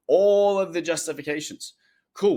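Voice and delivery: ordinary talk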